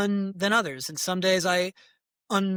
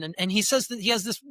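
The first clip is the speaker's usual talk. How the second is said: normal talking voice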